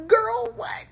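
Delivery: high, loud disbelieving shriek at the character; shocked outrage. Real, not acted. Vlogger yelling at the anime character in disbelief.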